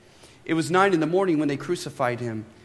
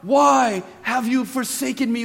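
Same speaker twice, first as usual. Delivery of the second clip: higher, louder cry of anguish, slowing at the end; 6 semitones higher, 6 dB louder than usual. A narrator's reading. Jesus on the cross cries out to God, loud and anguished. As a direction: cry out to heaven in agony. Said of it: "cried out in a loud voice".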